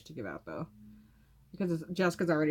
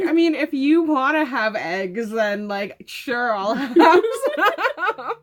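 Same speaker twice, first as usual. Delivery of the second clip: slow, higher, laughing offer; coy fake indifference, drawn out; mock casual offer; 5 semitones higher, 10 dB louder, much slower than usual. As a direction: pretend not to want any, laughing. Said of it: "be like".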